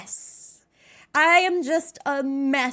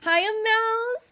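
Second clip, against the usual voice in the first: ordinary talk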